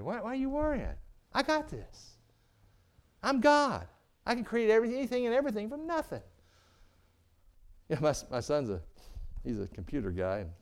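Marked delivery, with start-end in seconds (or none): 0.0-6.2 a hint of God, higher and bemused; lightly voiced God, puzzled at his people; God speaking. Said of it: "So God looks at you and says".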